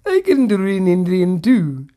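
loud, hammed-up Indian accent; badly faked, loud Indian accent. Shown as a demonstration. Host dared by co-host, loud terrible Indian accent. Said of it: "Now do Indian".

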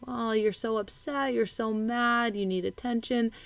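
soft, tender validation; imitated soothing parent. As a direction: gently soothe an upset child in your lap. Voices parent validating upset child on lap.

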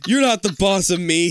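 smug little brother. A narrator's reading. Little brother taunting the older sibling, smug. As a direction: gloat it like a bratty brother. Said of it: "he says smugly".

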